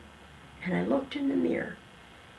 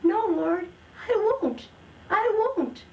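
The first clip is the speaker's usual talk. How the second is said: much higher and louder, earnest vow; fervent promise; 11 semitones higher, 3 dB louder, slower than usual. Retold or imitated. Answering the Lord's voice, fervent and much higher. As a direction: cry out the promise with all your heart. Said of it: "And I said".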